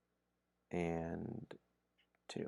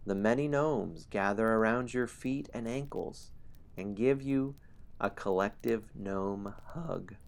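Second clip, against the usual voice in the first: ordinary talk, nothing marked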